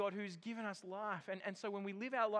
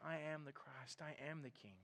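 soft, calm voice, much softer; quiet declaration; 5 semitones lower, 9 dB quieter than usual. Retold or imitated. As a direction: say it gently but with certainty. Jesus voiced in a sermon.

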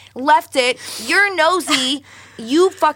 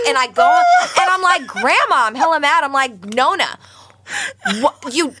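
hella mad; 6 semitones higher than usual. Retold or imitated. Retold anger at grandma.

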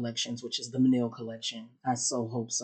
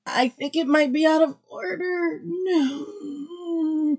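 high distress; 4 dB louder, slower than usual. Real, not acted. Panic article out of order.

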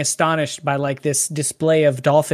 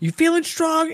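Dinklage's author taunting; 12 semitones higher than usual. Retold or imitated. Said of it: "and he's like".

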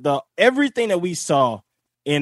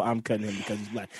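much lower, softer and fast; tossed off quietly, lower and quick; under the breath; 10 semitones lower, 11 dB quieter, faster than usual. Retold or imitated. Contrasting what she said and meant.

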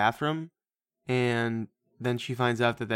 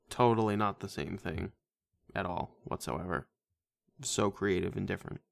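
bitter sarcasm; 5 semitones lower, 8 dB quieter than usual. Real, not acted. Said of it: "Oh, someone's bitter".

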